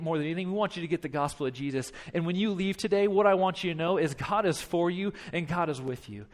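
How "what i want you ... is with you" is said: ordinary delivery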